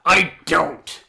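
loud, indignant retort; mock offended. Character accused of peeking at calendars, loudly offended. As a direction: blurt it out, deeply affronted. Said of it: "has the most offended look".

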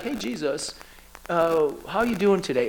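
mock casual greeting, tossed off quietly; easygoing hello with no urgency at all. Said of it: "It wasn't that".

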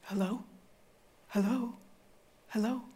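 soft, questioning hellos; tentative lone caller. Retold or imitated. Last prank caller asking into silence, soft and tentative. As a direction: call softly into an empty line.

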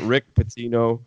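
ordinary delivery

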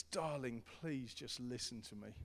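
gentle, hushed parental plea; tender weary father; soft plea to child. Retold or imitated. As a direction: coax a child softly to listen. Father pleading softly with kids.

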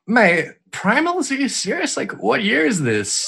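high, slow disbelief; are you kidding me; incredulous. Retold or imitated. Incredulous quote to game writers.

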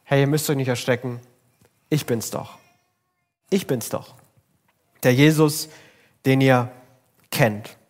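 soft, quiet, calming voice; gentle reassurance. Retold or imitated. Jesus spricht leise beruhigend zu den verängstigten Jüngern. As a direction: soothe frightened friends softly. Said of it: "mitten in die Panik hinein".

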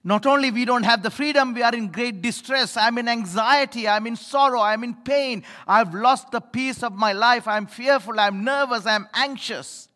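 fervent sermon cry, slowing on the last words; impassioned preaching. Acted. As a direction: pour it out from the pulpit. Preaching at full force.

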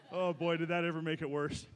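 usual speaking voice

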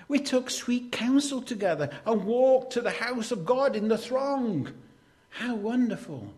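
high, loud, glowing recitation; swept-up, celebratory reading; exultant recollection. Acted. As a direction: proclaim it high and loud like a happy memory. Acting David's happy memory of worship.

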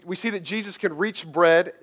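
ordinary talk, nothing marked

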